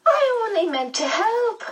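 very high, plaintive character wail; high-pitched, upset medium. A narrator's reading. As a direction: wail it way up in the head voice. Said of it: "the medium wailed".

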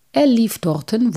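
plain reading voice

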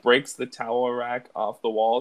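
usual speaking voice

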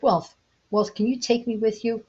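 Love calling out to Wealth, pleading. Acted. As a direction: beg for a place on the boat. Love begs passing Wealth for help.

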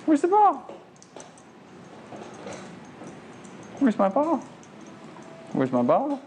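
high, bright sing-song for the dog; excited dog-training voice; dog-talk voice. Real, not acted. Trainer playing fetch with dog.

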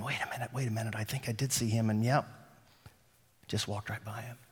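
imagined crowd's hushed realization; hushed inner voice. Retold or imitated. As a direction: mutter it like a thought creeping in. Voicing audience's dawning realization.

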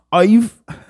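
high-pitched mock-angry British accent; mad British person. Reading an angry British meme, mock British accent. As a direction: start an indignant British outburst, then break off. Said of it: "British people get mad and be like".

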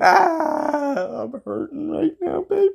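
very high hammed-up crying voice; wailing. Dumped man telling Marianne he is hurting, mock tearful wail. Mock breakdown over the breakup.

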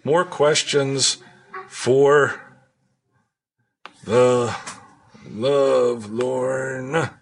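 slow, drawn-out show announcer. Acted. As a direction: announce it slowly and grandly. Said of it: "announcing segment title".

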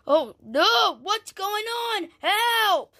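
high-pitched cry for help; mock distress call; pretend alarm. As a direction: play panicked, voice jumping up. Pretend character calls for help.

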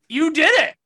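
loud and high, jabbing at did; furious accusation; angry outburst. Real, not acted. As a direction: yell it at him in disbelief. Angry rant about the boyfriend.

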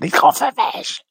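squeaky high mock gasp of the crowd; mock scandalised audience. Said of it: "Everyone's thinking".

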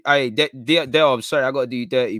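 usual speaking voice

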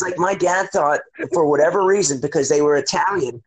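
ordinary delivery

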